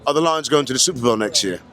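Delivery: put-on London "All right then"; London accent. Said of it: "doing the accent".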